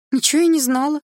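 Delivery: very high, loud denial; flustered muttering. A narrator's reading. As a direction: deny everything in a high, evasive voice. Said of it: "бормотала Милана".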